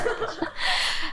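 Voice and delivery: a high, airy burst of laughter; real laugh. Real, not acted. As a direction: laugh at how silly it was. Amused at the celebration. (思わず高い声で笑う)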